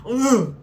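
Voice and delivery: high, loud "ugh"; sudden exasperated groan. Real, not acted. Groan pausing in her own story.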